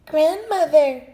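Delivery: high, small put-on voice of the wolf; wolf squeaks sweetly, faking a girl; little voice. A narrator's reading. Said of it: "called in a little voice".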